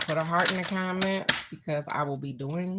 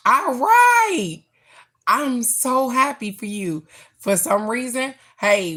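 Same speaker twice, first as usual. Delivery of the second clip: much higher and louder, beaming; excited cheer; 4 semitones higher, 6 dB louder than usual. Real, not acted. Host cheers a viewer's beach trip, excited and loud. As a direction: cheer her on at full voice.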